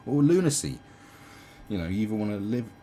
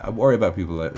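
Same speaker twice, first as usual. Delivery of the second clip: ordinary talk, nothing marked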